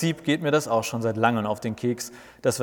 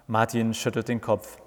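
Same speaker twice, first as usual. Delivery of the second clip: plain reading voice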